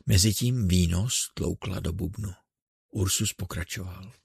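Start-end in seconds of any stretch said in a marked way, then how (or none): none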